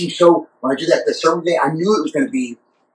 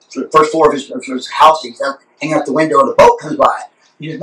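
usual speaking voice

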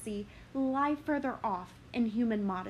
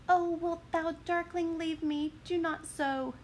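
higher, desperate plea; cling to him with your voice; abandoned girl begging, higher voice; Helena pleading; 6 semitones higher than usual. Acted. Helena begs.

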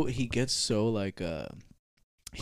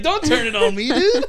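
high laughing push-back, voice cracking up; laughing protest; 13 semitones higher, 7 dB louder, slower than usual. Retold or imitated. Retelling argument with Pop, laughing.

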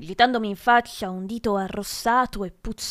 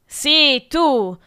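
raised, punched confirmation; loud accusation; 4 semitones higher, 8 dB louder than usual. A narrator's reading. Teacher singling out the pupil as culprit, loud and accusing. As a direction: pin the blame on him loudly. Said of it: "proseguì l'insegnante".

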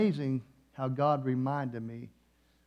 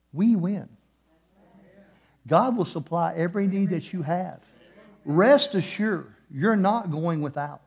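loud, higher preaching climb; full-force preaching; 3 semitones higher, 6 dB louder than usual. Acted. Preacher telling the congregation of crying on stage, loud and rising. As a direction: preach it out to the crowd.

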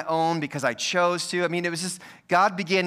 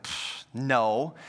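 soft scoffing denial with a dismissive pfft; 6 semitones lower, 5 dB quieter than usual. Retold or imitated. Teen brushing off the deputy, soft scoffing denial. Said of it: "I was like".

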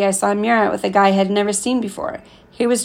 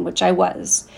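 ordinary delivery, nothing marked

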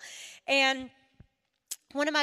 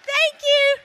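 ordinary talk

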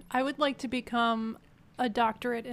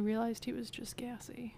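whispering; 9 dB quieter than usual. Real, not acted. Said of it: "whispered joke".

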